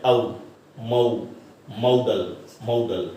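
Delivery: low, separated repeats of ਮਾਡਲ with an 'au' glide; correct pronunciation drill. Shown as a demonstration. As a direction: build ਆਊ, ਮਾਊ into MOW-dul ਮਾਡਲ. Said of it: "ਸੋ ਇਸ ਦਾ ਉਚਾਰਨ ਕੀ ਹੈ?". (ਆਊ, ਮਾਊ, ਮਾਡਲ: ਨੀਵੀਂ ਸੁਰ ਵਿੱਚ ਵੱਖ-ਵੱਖ ਕਰਕੇ ਉਚਾਰਨ)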